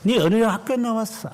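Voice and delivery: high mocking interrogation; mock barracks bully, pitched high; petty bully. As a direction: ape a small-minded bully grilling a recruit. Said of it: "이런 거 물어보는 놈들".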